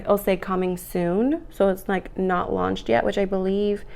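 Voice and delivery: normal talking voice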